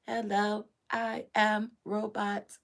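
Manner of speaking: slow flat robot voice. Retold or imitated. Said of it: "Gen Zs came in like".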